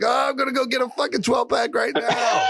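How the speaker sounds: hammed-up eager yell; mock excited shout. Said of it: "And you're like".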